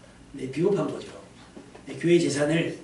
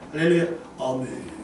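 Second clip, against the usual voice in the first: low, sleepy drone; half-asleep response; 6 semitones lower, 3 dB quieter than usual. Retold or imitated. Dozing choir member answering the preacher, half-asleep drone. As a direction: mumble praise as if dozing off. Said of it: "자는 건지 깨는지 모를 정도로".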